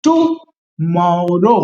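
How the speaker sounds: Tomorrow stretched out very slowly, syllable by syllable; slow pronunciation demo. Shown as a demonstration. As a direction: drag tomorrow out slowly so the class can copy it. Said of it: "पहले धीरे बोलिए".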